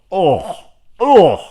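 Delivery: a high, loud anguished groan; pained groan. Real, not acted. Fan reliving the saddest death, with a high anguished groan. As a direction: groan like it physically hurts. Reacting to the sad scene.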